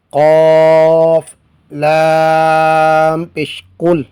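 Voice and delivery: drawn-out, chanted letter-by-letter spelling; slow spelling recitation. Shown as a demonstration. Teacher slowly chanting the spelling of each word for students. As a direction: stretch each letter name like a Quran teacher.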